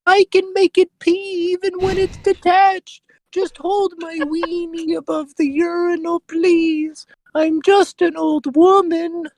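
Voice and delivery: put-on very high, slow falsetto old lady voice, wailing; whimpering old woman begging for help; old woman character. To the listener in the bathroom, a wailing falsetto granny. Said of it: "And she goes".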